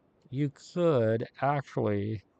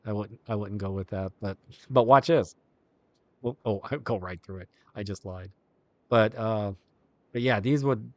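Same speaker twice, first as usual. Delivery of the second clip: usual speaking voice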